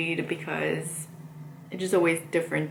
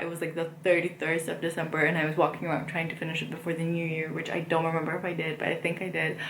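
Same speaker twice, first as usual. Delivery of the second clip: hurried, rattled-off recollection; quick run-on chatter, casually tossed out; talking fast; faster than usual. Real, not acted. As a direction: rush through the memory without stopping. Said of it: "Why am I talking so fast".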